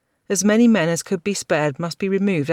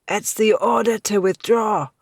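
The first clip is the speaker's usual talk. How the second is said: wheezing; 6 semitones higher, 4 dB louder than usual. A narrator's reading. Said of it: "wheezed Seneschal Fingal".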